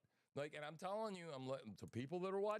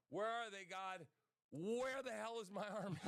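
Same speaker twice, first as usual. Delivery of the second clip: exasperated plea to God; 8 semitones higher than usual. Retold or imitated. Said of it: "I'm going".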